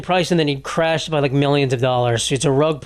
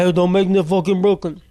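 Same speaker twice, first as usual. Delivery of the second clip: mock Brooklyn tough guy; Eric Adams; 2 semitones higher than usual. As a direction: imitate a man threatening to go Brooklyn. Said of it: "he was like, he was like".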